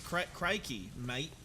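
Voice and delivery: hammed-up Aussie accent; broad mock Australian accent. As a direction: play the stock Aussie bloke. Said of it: "You love Australian accents?".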